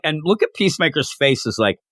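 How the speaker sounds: ordinary talk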